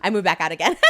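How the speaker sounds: ordinary delivery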